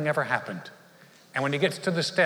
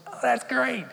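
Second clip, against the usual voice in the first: much higher, eager oh that's great; excited boy; 10 semitones higher than usual. Retold or imitated. Boy answering grandpa's invite, thrilled. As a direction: jump at the fishing trip. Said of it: "Michael goes".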